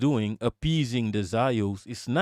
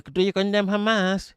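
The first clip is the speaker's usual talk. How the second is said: mocking interviewer; 6 semitones higher than usual. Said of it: "making fun of Piers Morgan for".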